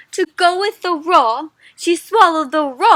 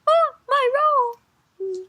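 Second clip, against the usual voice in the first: slow high whisper of dismay; shocked kid; 12 semitones higher, much slower than usual. Acted. Kid whose roll was eaten, whispering in shock. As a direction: breathe it out high and slow, crushed. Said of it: "Kind of, like, shocked".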